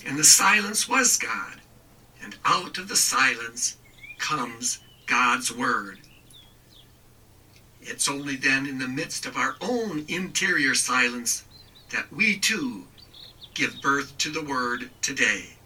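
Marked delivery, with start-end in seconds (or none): none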